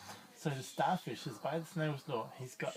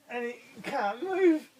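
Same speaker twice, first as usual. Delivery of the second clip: ordinary delivery, nothing marked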